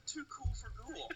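snooty brush-off. Retold or imitated. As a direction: look down your nose at the customer. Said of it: "Shoulda, shoulda said".

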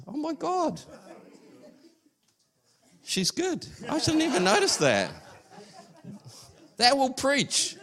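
high, laughing wonder at a small detail; real thrilled surprise, pitched high with laughs; delighted amazement. Real, not acted. Marvelling at a detail in Scripture.

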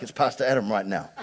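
normal talking voice

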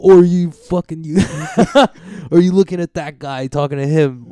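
hammed-up confrontation; mock angry challenge. Riffing as the ref who yelled, mock angry challenge.

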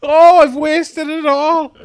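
high, loud wail of regret; hammed-up panic about lost youth; mock despair.

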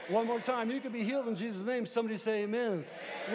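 loud rising preacher call; preaching shout. Acted. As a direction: whip the church up, shout it. Call and response climax.

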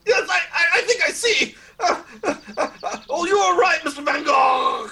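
high, loud flustered agreement; nervous placating. Acted. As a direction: stammer it out to calm him down. Nervous interviewer agreeing under pressure.

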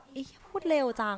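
loud, high-pitched burst of dismay; acted exclamation. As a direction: blurt it out fast, reliving being overwhelmed. Acts out reaction to fast speech.

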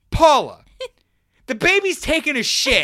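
high, loud exasperated snap; annoyed dad. Retold or imitated. Dad to panicking wife, loud exasperated snap. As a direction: snap it like a fed-up husband. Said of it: "super fucking annoyed look".

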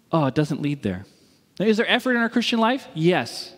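normal talking voice

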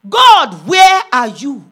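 very loud, slow, drawn-out call; crying out to God. Acted. Woman questioning God in her ministry, loud slow cry. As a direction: call out forcefully and stretch each word.

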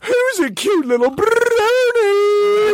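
high, slow cutesy pony voice; baby talk. Pretend.

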